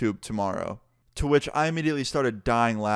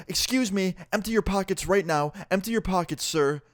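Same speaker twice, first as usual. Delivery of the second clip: a pushy, higher-pitched stranger confronting him; accusing Karen; 6 semitones higher than usual. Retold or imitated. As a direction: demand it like an outraged shopper. Karen confronts teller about stealing.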